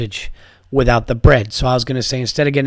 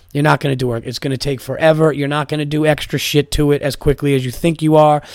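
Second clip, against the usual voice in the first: ordinary talk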